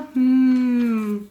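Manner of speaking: long steady hum out; humming exhale. Shown as a demonstration. Swim coach demonstrates exhaling, long hum. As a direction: hum the air out underwater.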